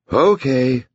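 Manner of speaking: high-pitched, irritated concession; irritable. A narrator's reading. Mother gives in to her daughter, irritable. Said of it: "Bonnie said irritably".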